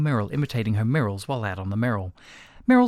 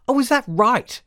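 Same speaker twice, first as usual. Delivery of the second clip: high, loud sarcastic query; 12 semitones higher, 7 dB louder than usual. A narrator's reading. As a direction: throw it back with loud, jaded sarcasm. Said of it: "a sarcastic".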